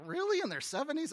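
high, incredulous question; a wife who can't believe it; surprised wife. Retold or imitated. Wife's incredulous reply.

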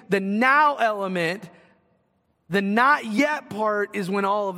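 full-force sermon build; fervent preaching. Acted. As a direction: press the point home to the congregation. Preaching with rising intensity.

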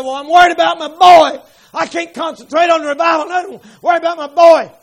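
loud, high pleading mother; worried mother. Retold or imitated. Mother begs husband, loud and worried. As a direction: cry out a worried plea for her boy.

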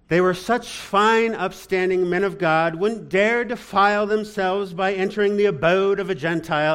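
put-on loud, high-pitched theatrical English accent; hammed-up English stage voice; mock English accent. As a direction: go grandly English, like an old thespian, in sarcastic praise. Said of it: "I went English there".

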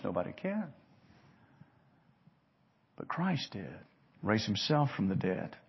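ordinary talk

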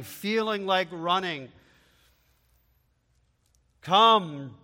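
builds from speaking to a loud shout; fervent altar call climbing to a shout; rising preaching call. Acted. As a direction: call them to come, growing stronger.

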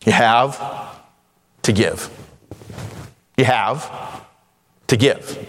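"You have", a pause, then "to give"; split phrasing. Shown as a demonstration. Preacher showing that having lets you give, pause after "have". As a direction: separate "have" from "to give", lifting "have". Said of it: "put the emphasis on the syllable".

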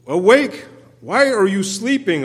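high, loud call to wake God; urgent loud plea; loud pleading cry. Acted. Psalmist's pleading cry read aloud.

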